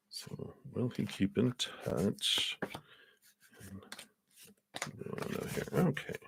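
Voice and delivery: hushed aside while laying out cards; whispered to herself. Real, not acted. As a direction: murmur under your breath as you work. Shuffling cards, murmuring to herself.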